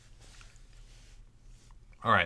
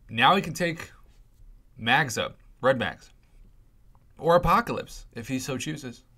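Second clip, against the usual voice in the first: ordinary talk, nothing marked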